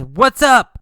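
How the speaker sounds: high, loud shout across the street; called out greeting. Acted. Carlos calling out to the girl, loud and high. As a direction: call out to get her attention. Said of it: "So Carlos says".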